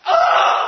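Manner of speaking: a sharp, very loud and high breathy cry; startled gasp. Acted. Elijah hearing the death threat, a high loud gasp. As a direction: gasp in shock.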